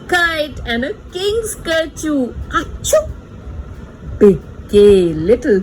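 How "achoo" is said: hammed-up achoo; sneeze. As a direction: fake a big sneeze for the child. Acting out a sneeze.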